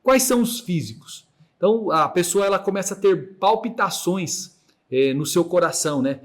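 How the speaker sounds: ordinary talk, nothing marked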